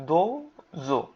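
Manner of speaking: দোজো split into two long beats, দোও then জো; syllable by syllable. Shown as a demonstration. Teacher breaking a new word down for learners, syllable by syllable. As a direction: break দোজো apart slowly with a pause between syllables. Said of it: "দো এর সাথে উ আছে".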